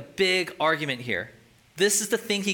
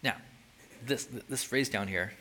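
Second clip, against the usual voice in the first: usual speaking voice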